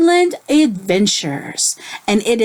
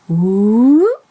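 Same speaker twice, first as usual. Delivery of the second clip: playful ooh; 4 dB louder than usual. Acted. Teacher drawing circle.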